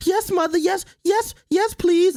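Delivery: high, fawning mock fans repeating yes mother; hammed-up high groveling fan voice. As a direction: squeak it out like a devoted fan. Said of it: "and they be like".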